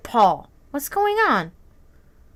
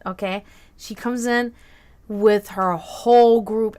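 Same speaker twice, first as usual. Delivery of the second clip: usual speaking voice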